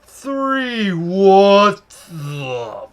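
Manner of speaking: stretched, drawn-out slow-motion words; mock slow motion. Cashier acting out scanning items, in mock slow motion. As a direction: drag the words out as in a slowed-down film. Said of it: "we're going slow motion here".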